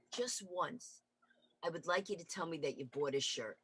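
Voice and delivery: low, soft, weary sigh of a line; tired boyfriend wishing for normal news; weary husband. Retold or imitated. Boyfriend answering her, weary and soft. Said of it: "he goes".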